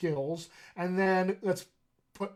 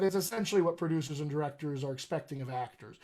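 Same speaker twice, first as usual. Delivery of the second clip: ordinary talk, nothing marked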